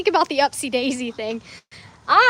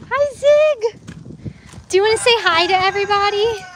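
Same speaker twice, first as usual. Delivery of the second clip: high, fast, sing-song greeting to a bird; pet talk; 7 semitones higher, 3 dB louder than usual. Real, not acted. Owner greeting her pet bird for viewers, high pet voice.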